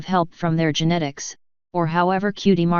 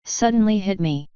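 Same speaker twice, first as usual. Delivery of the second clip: usual speaking voice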